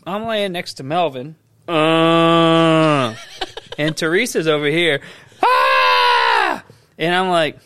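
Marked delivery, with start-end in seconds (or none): 1.7-3.2 long, low, steady groan; low drawn-out "uhhh"; mock dying groan. Imitating Melvin lying wounded. 5.4-6.6 one long held "aah"; drawn-out, hammed-up cry; mock victim's scream. Imitating Teresa screaming nearby.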